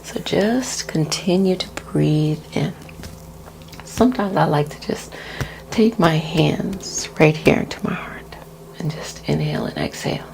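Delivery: guided breathing. Acted. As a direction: ease the listener into each breath.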